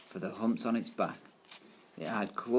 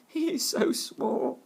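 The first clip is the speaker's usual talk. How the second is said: put-on much higher, slow jeering child voice; mocking children; 4 dB louder, slower than usual. A narrator's reading. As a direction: taunt him like a schoolkid. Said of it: "pointed at Ruskin and cried".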